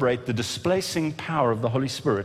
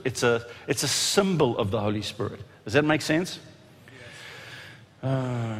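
ordinary talk, nothing marked

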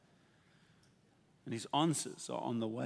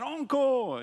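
mock crowd shout, pitched way up; high, drawn-out call for more; imitating a shouted encore; 12 semitones higher, 6 dB louder than usual. Audience calls the opera singer back onstage, high mock shout. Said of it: "and they shouted".

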